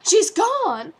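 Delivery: high, loud panicked cry; alarmed. Acted. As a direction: blurt it out in alarm. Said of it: "announced hurriedly".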